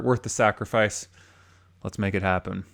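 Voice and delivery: normal talking voice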